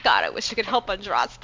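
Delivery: ordinary delivery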